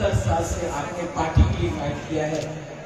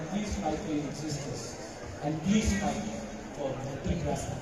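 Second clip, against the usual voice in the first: slow, soft, earnest appeal to the people; emotional plea; 5 dB quieter, much slower than usual. Acted. Leader pleading with his people to unite, slow and soft. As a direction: draw each please out, begging them gently.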